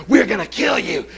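mock furious comment; mock hostile reply. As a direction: read out the online attack in mock outrage. Retold hostile online replies.

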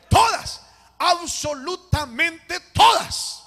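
loud, high, drawn-out proclamation; shouted sermon climax, slow and emphatic; full-force preaching. Real, not acted. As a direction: preach it with force, stretching the key words.

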